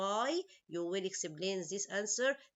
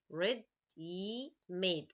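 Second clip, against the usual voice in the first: Ready-made said very slowly, syllable by syllable; split into syllables; much slower than usual. Shown as a demonstration. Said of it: "Three syllables".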